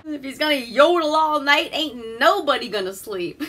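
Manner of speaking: high, loud mock of a movie character; mimicked film character. Mimics the film clip just played.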